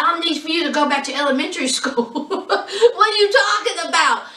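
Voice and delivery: mock scolding breaking into laughter; laughing disbelief. Vlogger answering a stranger who doubted Virginia is Southern, laughing disbelief.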